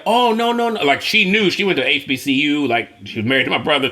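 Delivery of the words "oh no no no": flustered denial. Retold or imitated. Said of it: "And she was like".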